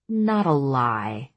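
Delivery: ordinary delivery, nothing marked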